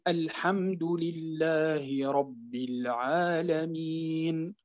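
slow chanted recitation, العالمين ending on a still نون; Quran recitation. Shown as a demonstration. Teacher demonstrating a pause at العالمين for students, slow chanted recitation. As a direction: recite الحمد لله رب العالمين slowly, stopping on a silent final نون. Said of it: "عند الوقف مثلًا".

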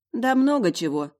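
high, loud brush-off; dismissive. A narrator's reading. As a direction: wave the question away. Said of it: "отмахнулась я". (отмахнуться, не объясняя)

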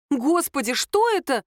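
high-pitched astonished question; startled. A narrator's reading. Lera seeing her friend's video, startled. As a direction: gasp the question in disbelief.

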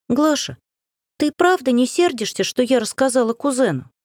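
put-on high, guilty girlish voice; sheepish little apology, pitched high; guilty young girl. A narrator's reading. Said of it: "виновато проговорила она".

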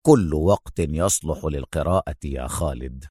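low, soft, easy answer; smiling Adham. A narrator's reading. Adham replies to Khaled, low and easy. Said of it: "ابتسم أدهم وقال".

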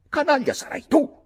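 high, loud furious yell; shouted. Acted. Redmond shouting back at Mick, high and furious. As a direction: hurl the insult back at full voice. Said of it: "urlai io".

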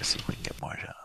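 soft unvoiced whisper. Real, not acted. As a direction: whisper the dialing aside. Whispering while dialing.

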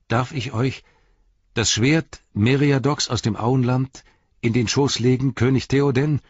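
calling out. A narrator's reading. Merry offering his sword to King Theoden, calling out. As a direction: call it out while kneeling, fervent. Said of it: "rief er".

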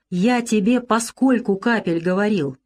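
plain reading voice

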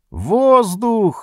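high alarmed shout. Acted. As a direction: call out the warning. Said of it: "закричал один из матросов".

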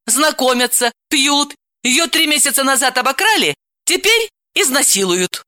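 high, exasperated rant; worked-up, cross woman; infuriated. Acted. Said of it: "разъярилась Далила".

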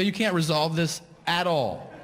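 baffled customer losing patience; exasperated disbelief. Retold or imitated. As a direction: challenge a useless cashier. Exasperated customer confronts cashier.

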